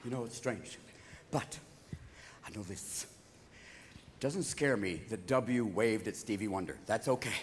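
low, soft, confidential aside; hushed confiding. Acted. Comic confiding in the audience, low and hushed. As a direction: drop right down as if confiding.

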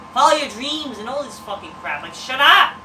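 ordinary talk, nothing marked

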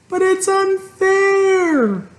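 very high, slow tearful cry. A narrator's reading. Fern cries to her father, very high. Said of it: "cried Fern".

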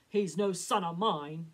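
loud, slow final verdict; cold declaration. A narrator's reading. Papa to Mama, loud and final. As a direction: disown him flatly. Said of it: "declared Papa".

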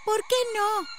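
put-on high girl's voice; a young girl asking, pitched well up; questioning girl. A narrator's reading. Annie asks, voiced as girl.

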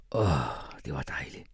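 soft contented sigh, low and half-whispered. A narrator's reading. Father after a big gulp of coffee, sighing softly. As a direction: sigh it out softly, almost without voice. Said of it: "sukkede han".

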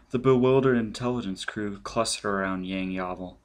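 usual speaking voice

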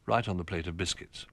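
ordinary delivery, nothing marked

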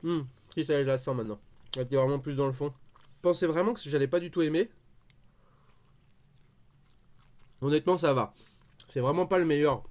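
eating while speaking; talking while chewing. Real, not acted. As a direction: mouth partly full. Seen 1: Bites kebab at -2, then talks chewing, holding it throughout.

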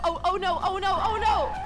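normal talking voice

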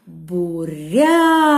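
буряк called with a long, loud РЯ; calling out. Shown as a demonstration. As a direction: let the last syllable of буряк soar and hold. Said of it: "Гукаємо".

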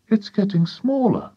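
put-on high boy's voice; young boy. A narrator's reading. George watching the hen shrink, high boy's voice. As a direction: report it high, in wonder.

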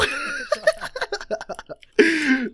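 long, high fit of laughter; high-pitched laughing. Real, not acted. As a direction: crack up helplessly after a fail. Laughs at the disaster.

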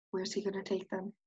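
ordinary delivery, nothing marked

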